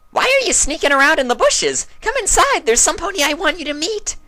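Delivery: high, chirpy pony voice; eager, squeaky invitation; Pinky's bubbly voice. A narrator's reading. Pinky calling a friend inside, high and bubbly. Character voice.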